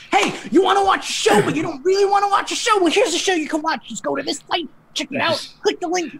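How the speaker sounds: high, hyped-up announcer voice. Retold or imitated. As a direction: hype it like a fighting game announcer. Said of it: "my announcer voice going".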